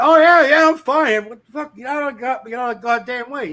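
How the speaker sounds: high loud brush-off. Retold or imitated. Telling the worried coworker to get out of the way, loud mock irritation. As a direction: shoo him off like you're still fuming.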